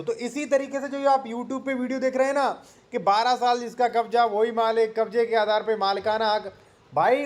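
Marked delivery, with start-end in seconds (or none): none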